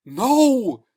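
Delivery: high, loud terrified cry. Acted. Man refusing to stay the night, terrified cry. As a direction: cry out in panic. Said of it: "face melting with terror".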